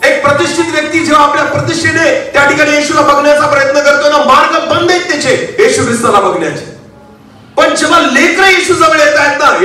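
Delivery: high-pitched, insistent sermon delivery. Acted. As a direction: preach it high and sustained.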